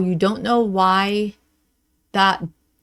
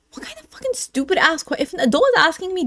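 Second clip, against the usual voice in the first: high-pitched scandalized outburst; indignant disbelief; 8 semitones higher, faster than usual. Pretend. Adult reacts to being asked about a nap, indignant. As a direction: squeal in outrage at the question.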